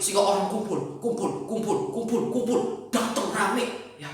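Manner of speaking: ordinary talk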